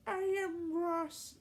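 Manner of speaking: put-on kid voice. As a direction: speak as a small boy. Ross kid voice.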